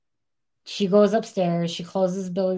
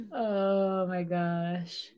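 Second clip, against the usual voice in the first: slow disbelief; 7 dB quieter, much slower than usual. Real, not acted. Stunned by the story.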